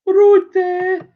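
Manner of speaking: very high, loud Brummie imitation; badly faked Brummie accent; attempted Brummie accent. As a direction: squeal it in mock Brummie. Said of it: "I can't do brown accent at all".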